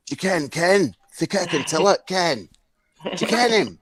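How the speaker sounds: mock Stirling local voice; imitated Stirling accent. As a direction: mimic a Stirling stranger firing off "ken". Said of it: "people were coming up to me going".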